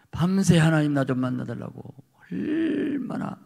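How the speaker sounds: usual speaking voice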